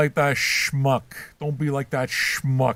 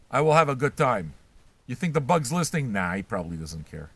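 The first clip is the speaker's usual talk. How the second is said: ordinary talk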